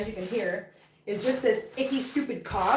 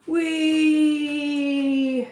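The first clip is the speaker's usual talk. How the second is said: playful wheee; 2 semitones higher, 4 dB louder than usual. Real, not acted. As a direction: let out a sustained, gliding whee. Playful exclamation while moving about.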